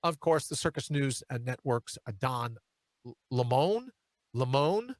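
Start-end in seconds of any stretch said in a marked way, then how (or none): 3.3-3.9 Lemon said as "luh-MOAN", rising at the end; second syllable stressed. Shown as a demonstration. Host fumbling the anchor's name, Lemon as "luh-MOAN". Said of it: "however he pronounces it". 4.3-4.9 Lemon as "luh-MOAN", lifting at the end; second syllable stressed. Shown as a demonstration. Host trying out the anchor's name, Lemon as "luh-MOAN". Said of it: "however he pronounces it".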